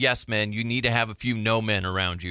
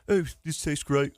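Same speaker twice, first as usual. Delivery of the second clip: imitated English accent; 4 semitones higher than usual. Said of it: "I don't even know his accent".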